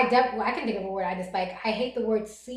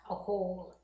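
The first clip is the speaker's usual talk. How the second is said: ordinary talk, nothing marked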